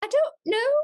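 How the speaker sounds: squeaky servant voice held; high Cockney voice. Pretend. Answering the question still in Gustav's voice.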